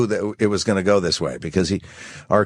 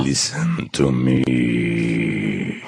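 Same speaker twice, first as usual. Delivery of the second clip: very slow, low, growled Russian accent; drawn-out growl in a low Russian accent; tiger growl; 10 semitones lower, much slower than usual. Shown as a demonstration. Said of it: "like a tiger growl to it".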